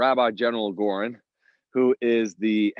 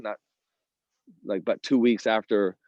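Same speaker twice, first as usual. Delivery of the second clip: ordinary talk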